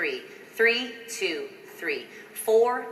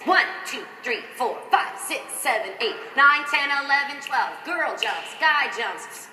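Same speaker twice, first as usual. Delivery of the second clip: energetic count; 5 semitones higher than usual. Shown as a demonstration. Choreographer pumping up dancers through twelve counts. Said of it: "we're gonna do that for twelve counts".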